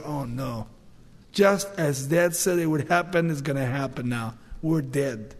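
low, hushed, sinking voice; mild dread. Acted. Brothers finding the cup in Benjamin's sack, mild hushed dread. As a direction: drop down soft in understated resignation.

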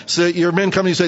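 usual speaking voice

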